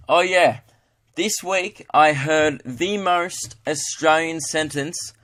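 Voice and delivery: ordinary delivery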